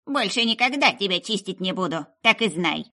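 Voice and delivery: put-on shrill-free loud old witch scolding the runaway; angry witch scolding. A narrator's reading. Witch scolding the striped runaway, loud and cross. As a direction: shake a fist and scold.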